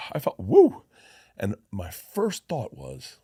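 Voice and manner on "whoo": whoo. Retold or imitated. Sudden numbing rush retold.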